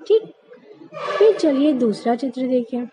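ordinary delivery

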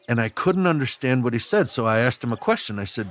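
ordinary talk

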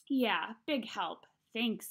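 usual speaking voice

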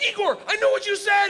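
high, loud eager shout; excited shout. Retold or imitated. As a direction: yell it like a proud student. Excited reply to Igor.

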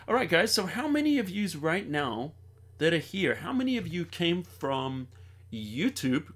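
ordinary talk

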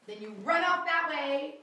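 loud, slow, drawn-out direction; calling out. Real, not acted. Director calling moves to the cast, loud and drawn out. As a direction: call directions across the stage slowly.

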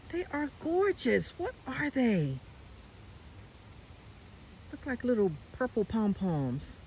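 high-pitched, real wonder; delighted awe at a lovely find; amazed delight. Real, not acted. Marvelling at flowers just spotted.

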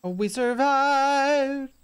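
slow, high, drawn-out cheer; relieved cheer. Real, not acted. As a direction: stretch it out with relief. After the near crash.

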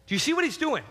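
ordinary delivery

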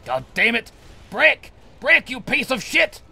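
high, loud, furious commands; exasperated shouted cursing; frustrated yelling. Real, not acted. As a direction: yell at the thing that won't break. Yelling at the object to break.

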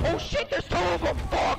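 shouting. Real, not acted. Ambushed by two enemies.

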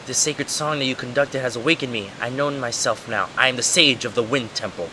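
soft, solemn sage; awakened Makar. Acted. Makar awakened as sage addressing Wing, softly solemn. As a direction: read it softly, with quiet solemnity.

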